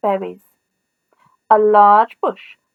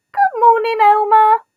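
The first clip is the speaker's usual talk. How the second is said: bright, loud, higher hello; cheery greeting; 9 semitones higher, 6 dB louder than usual. A narrator's reading. Animals calling a cheerful good morning to Elmer. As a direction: call out a happy good morning.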